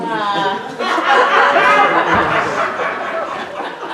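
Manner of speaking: a long hearty laugh at his own joke. Real, not acted. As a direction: let the laugh roll out freely.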